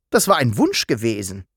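plain reading voice

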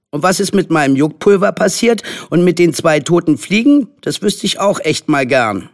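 put-on indignant high child's voice; angry complaint. A narrator's reading. Charlie complaining about Henry's night-time tricks, angry. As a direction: pile up grievances like an outraged boy. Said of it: "hatte Charlie sich wütend beklagt".

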